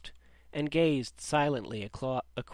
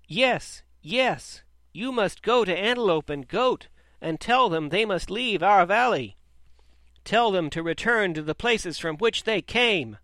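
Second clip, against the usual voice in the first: loud, high rallying shouts; crowd shouting; 6 semitones higher, 7 dB louder than usual. A narrator's reading. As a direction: urge the leader on, loud and high. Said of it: "responded with shouts of".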